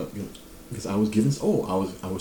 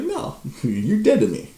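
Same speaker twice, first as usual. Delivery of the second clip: laughing dismissal; 6 semitones higher, 4 dB louder, slower than usual. Retold or imitated. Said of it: "I was like".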